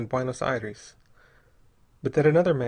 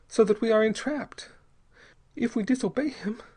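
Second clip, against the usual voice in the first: put-on high, pleading young woman's voice; frightened young mother; 9 semitones higher than usual. A narrator's reading. Young mother pleads with Bell, high and frightened. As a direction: voice her as a terrified wife begging.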